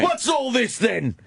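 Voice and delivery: slow mock British bobby, called out; hammed-up London copper, English accent. British bobby accent teasing the tourists, called out. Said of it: "They say things".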